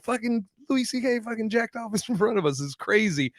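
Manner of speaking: high-pitched, amused retelling through a laugh; imitating laughing gossip. Female comics telling others at the festival, mock laughing disbelief. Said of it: "they're like laughing about it".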